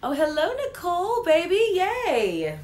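excited greeting. Real, not acted. Greeting a viewer on livestream.

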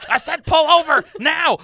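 loud high furious shouting; angry yelling. Acted. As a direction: bark at the reckless driver. Passenger yells at driver.

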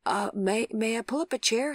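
nervously courteous, feeling the way in; tentative request. A narrator's reading. Visitor asking the patient to sit by her, hesitant.

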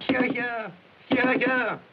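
loud cheering hear hear. Acted. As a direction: shout your approval. Clerk applauds nephew's speech.

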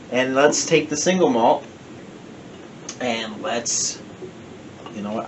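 normal talking voice

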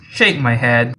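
ordinary talk, nothing marked

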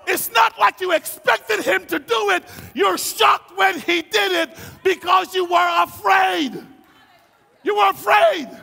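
high, loud, emphatic sermon delivery; full-force preaching. Acted. Preacher telling the congregation they acted from fear, shouting. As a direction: drive each phrase home with a shout.

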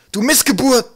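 high, very loud jeering yell; screaming insults. A narrator's reading. Trolls attacking Twig, screaming. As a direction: hurl abuse at the top of the voice. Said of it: "schrien sie".

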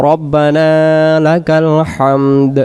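রব্বানা লাকাল হামদ slow, vowels held; prayer recitation. Shown as a demonstration. Teacher giving the standing praise, slow recitation. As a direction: draw it out and sound the final দ of হামদ. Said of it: "Tahmid যেটা বলবো আমরা".

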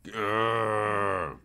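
spoken grrr as a playful growl; mock growl.

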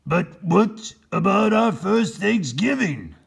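high, slow troubled voice; dismayed governor. Acted. Governor questioning Captain Bill, dismayed. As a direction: fret over the lost feast. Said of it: "said the governor".

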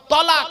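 তলাক with a short o and no long a, called out loud and high; তলাক, not তালাক, short o; correct tolak. Shown as a demonstration. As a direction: announce তলাক loudly, short o in the first syllable. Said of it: "শব্দটা হলো".

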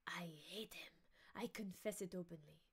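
breathy unvoiced whisper; whispered confession. Fernand admitting his hatred to Danglars, whispered. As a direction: hiss the hatred so nobody hears.

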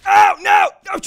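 loud high cry of dismay; high panicked outburst; alarmed yell. Real, not acted. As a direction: yell it as the hit lands. Gets hit in game.

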